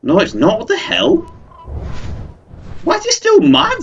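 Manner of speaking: very high-pitched indignant outburst; exasperated disbelief. Real, not acted. Gamer as the bird is still mad, exasperated disbelief. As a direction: squeal in real frustration. Creature still will not calm down.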